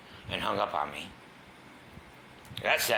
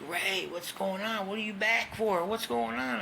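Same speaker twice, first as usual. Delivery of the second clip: high, concerned questioning voice of the staff; mock staff voice; 13 semitones higher, slower than usual. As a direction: play the surprised staff member, pitched high. Detox staff asking why he's back.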